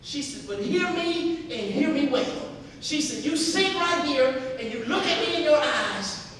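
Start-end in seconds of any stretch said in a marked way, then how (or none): 0.5-2.5 higher, louder, slow and emphatic; acted stern motherly insistence; firm mother. Mother firmly addresses crying son. 3.2-6.3 put-on loud, raised-pitch command to a child; acted motherly authority, emphatic; firm mother. Mother orders son to listen.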